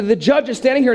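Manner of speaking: ordinary delivery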